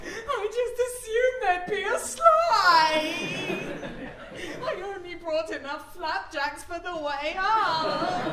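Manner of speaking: high, slow, loud tearful whine; mock sobbing. Comic clinging to the guide, slow mock sobbing. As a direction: blub it out in mock tears.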